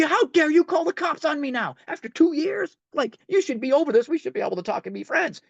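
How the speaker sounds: high, loud outraged rant; re-enacted indignant anger. Man walking home after ex called cops, re-enacted indignant rant. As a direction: replay the wounded ego's protest. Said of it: "I'm walking home and I'm mad".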